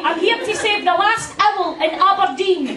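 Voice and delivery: high, earnest reporter singsong; local news reporter. Retold or imitated. Local TV reporter on a silly owl story, high and earnest. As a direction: chirp it like a keen rookie newsreader. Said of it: "You know that woman".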